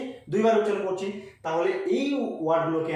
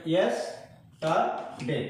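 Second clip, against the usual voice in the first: "Yesterday" slowly, split into three syllables; much slower than usual. Shown as a demonstration. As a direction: pause between the three beats of "yesterday". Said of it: "এখানে কটা syllable আছে".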